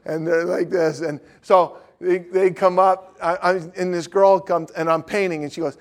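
ordinary talk, nothing marked

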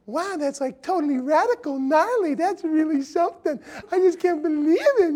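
put-on high airy valley-girl gush; a Valley Girl gushes over his painting. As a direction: gush like a blonde airhead. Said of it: "Valley Girl".

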